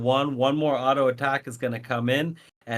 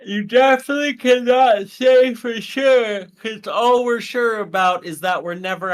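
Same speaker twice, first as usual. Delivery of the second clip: loud, high words stretched by a real yawn; talking through a yawn; 10 semitones higher, 7 dB louder than usual. Real, not acted. Caster agreeing with co-caster, talking through a yawn. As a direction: yawn right through the sentence, easing down at the end. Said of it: "No, I yawned".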